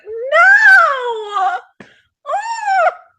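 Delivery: put-on high, loud teenage wail; whiny protest. Daughter protesting as dad takes her phone, high wail. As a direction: wail like your phone is being taken.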